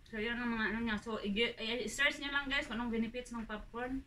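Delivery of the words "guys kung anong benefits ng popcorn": usual speaking voice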